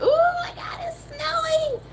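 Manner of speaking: very high, thrilled squeal; excited squeal. Retold or imitated. Southerners seeing snow at last, high excited squeal. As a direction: gush like you've never seen snow.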